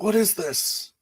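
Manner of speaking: high-pitched alarmed question; old man freaking out, voice very high; panicked old man. Acted. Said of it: "It's like".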